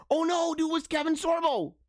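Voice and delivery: high-pitched startled dismay; high dismayed surprise. Real, not acted. Host spotting a name in the article, dismayed surprise. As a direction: exclaim in dismay, voice jumping up high.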